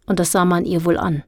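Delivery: usual speaking voice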